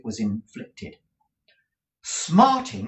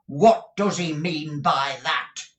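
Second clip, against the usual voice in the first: indignant demand; 6 semitones higher, 6 dB louder, slower than usual. Acted. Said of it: "replied Squeers".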